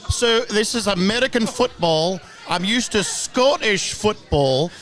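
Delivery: hammed-up Scottish accent with rolled R's. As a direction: play a proud Glasgow fan in a put-on Scottish accent. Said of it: "the trill of the R's is a very nice touch".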